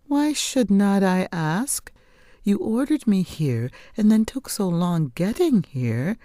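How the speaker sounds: indignant daughter. Acted. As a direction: push back at her mother, hurt and puzzled.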